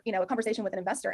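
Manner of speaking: ordinary delivery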